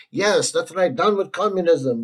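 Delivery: mock Korean accent gone astray; botched Korean accent. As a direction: attempt a Korean accent and miss.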